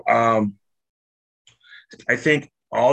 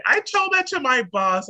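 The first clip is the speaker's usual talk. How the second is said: much higher-pitched mock friend's voice; imitated friend; 14 semitones higher than usual. Speaker imitating a friend telling on themselves, high mock voice. Acts out friend's proud report.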